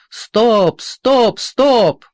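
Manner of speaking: repeated frantic shouts. A narrator's reading. Officer screams stop repeatedly, frantic. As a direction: scream it over and over. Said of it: "кричит офицер".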